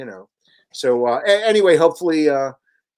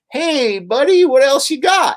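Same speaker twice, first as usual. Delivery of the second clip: higher, chummy publisher voice; a salesman sniffing for more; eager publisher; 11 semitones higher, 4 dB louder, slower than usual. Retold or imitated. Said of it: "the publishers come back to you and go".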